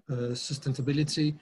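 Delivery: normal talking voice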